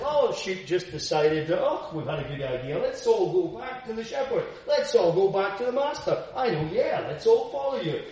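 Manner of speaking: high, loud, chirpy voice of silly sheep; hammed-up bleating flock deciding to go home; mock eager sheep. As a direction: play the sheep cheerfully making their own plan. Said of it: "it's not a sense of".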